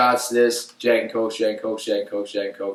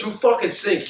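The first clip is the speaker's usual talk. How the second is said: retold angry coach; 9 semitones higher than usual. Coach insulting him.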